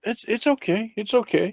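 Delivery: high, softened reassurance; calm someone who is shaking; anxious soothing. Acted. Visitor to the man he scared, anxious soothing.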